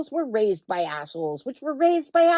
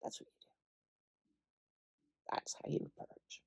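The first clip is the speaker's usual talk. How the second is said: whisper; 10 semitones lower, 24 dB quieter, faster than usual. Real, not acted. Whispered aside.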